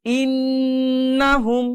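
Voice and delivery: জান্না with the ন্ন hummed long through the nose; held nasal ghunnah. Shown as a demonstration. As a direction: stretch the nasal hum in জান্না. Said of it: "গুনগুন আওয়াজ".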